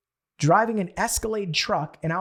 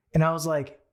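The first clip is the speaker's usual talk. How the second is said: usual speaking voice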